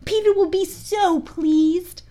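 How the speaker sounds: high, slow coaxing fairy voice; Tinker Bell. A narrator's reading. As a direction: coax the archer in a high fairy voice. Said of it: "she cried".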